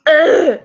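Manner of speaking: high, loud exasperated "ugh"; annoyed groan. Shown as a demonstration. Reader shows how the annoyed sigh sounds, annoyed groan. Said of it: "sounds annoying, like".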